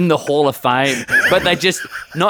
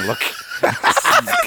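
high squeak of laughter; laughing. Real, not acted. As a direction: laugh out the words in disbelief. Horrified laughing about Elmo.

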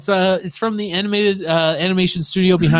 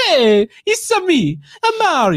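put-on Italian Mario accent; 11 semitones higher, 4 dB louder than usual. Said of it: "real Italian plumber".

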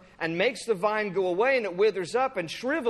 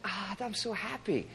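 soft mock-blissful sigh; swooning, hammed-up delight; Jonah suddenly happy; 8 dB quieter than usual. Jonah flipping from anger to joy, mock happy. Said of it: "one minute he's angry, and the next".

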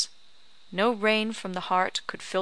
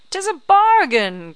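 much higher, louder, slow cry of triumph; triumphant cry; 11 semitones higher, 12 dB louder than usual. A narrator's reading. Old crone seals the bargain, crying out in triumph. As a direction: crow over the deal. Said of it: "cried the old crone".

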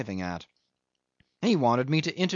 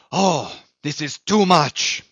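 loud, slow outraged shout; a full outburst of indignant protest; 5 semitones higher, 8 dB louder, slower than usual. A narrator's reading. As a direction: bellow it in outrage. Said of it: "he shouted".

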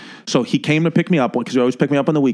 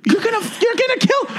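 screaming mom. Retold or imitated. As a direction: imitate a panicked mother's high-pitched scream. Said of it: "I hear my mom screaming".

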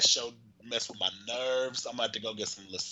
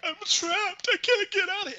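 high, put-on frightened whine; hammed-up trapped terror, pitched high; squealing like a scared kid; mock panic; 3 dB louder than usual. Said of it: "Aaron was like".

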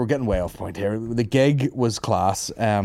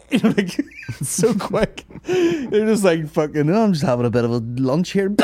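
laughing; 8 semitones higher, 4 dB louder than usual. Real, not acted. Laughing while describing coyote.

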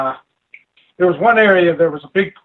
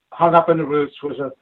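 normal talking voice

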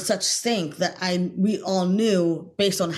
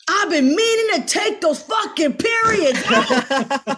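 high, loud mock shout at the actors; imitating director's shout; 11 semitones higher, 6 dB louder, slower than usual. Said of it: "And then he said".

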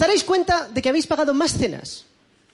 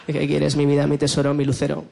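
lovey-dovey reply; 9 semitones lower than usual. Retold or imitated. Husband answering wife sweetly.

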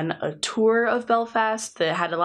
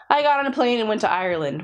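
ordinary delivery

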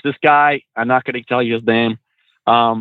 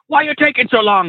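imitated impatient stranger, pitched high; mock a stranger picking a fight, voice up high; argumentative; 10 semitones higher than usual.

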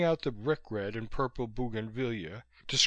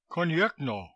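higher, lightly called reply; a brief, raised cry; cried out; 5 semitones higher, 4 dB louder than usual. A narrator's reading. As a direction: call back in Russian. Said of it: "cried Chandler's voice".

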